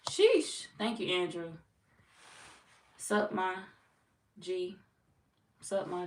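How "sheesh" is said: "Sheesh" shot up high and loud, falling. Real, not acted. Streamer hyping a pretty viewer, loud high sheesh. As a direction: hype it up high.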